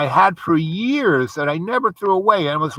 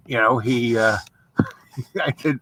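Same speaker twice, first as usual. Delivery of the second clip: usual speaking voice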